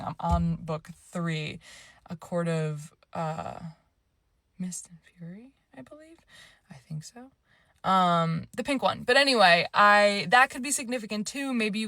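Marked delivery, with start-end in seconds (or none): 4.6-7.3 breathy whisper while recalling. Real, not acted. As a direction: whisper it to yourself. Trying to recall book title.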